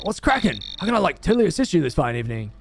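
chirpy, quick millennial voice. Acted. Millennial greeting officer at the door, breezy. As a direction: lilt it like an over-keen millennial.

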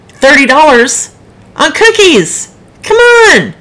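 loud, slow disbelief climbing to a high exclamation; indignant. Real, not acted. Outraged at thirty dollars for cookies.

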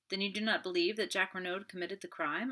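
ordinary delivery

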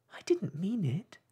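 gently begging to be forgiven; pleading. A narrator's reading. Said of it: "pleaded poor Alice".

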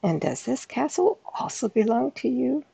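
the king, astonished, asks; high-voiced astonished king; surprised king.

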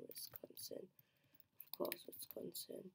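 breathy whisper of the name, to herself; whispered to self. Real, not acted. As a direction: whisper the answer under the breath.

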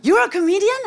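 high, loud question of disbelief; mock incredulous. Uber driver hears his passenger is a comedian, mock incredulous.

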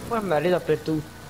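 mock Arabic accent in Italian; playful put-on Arabic accent in Italian. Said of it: "Perché iniziate a parlare arabo?".

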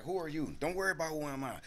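fast-talking high hustler voice; Axel Foley. Retold or imitated. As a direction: talk over them, fast and high. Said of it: "my Axel Foley".

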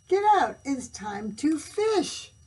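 high, loud command; bossy duke. A narrator's reading. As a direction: order the king out. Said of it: "said the duke".

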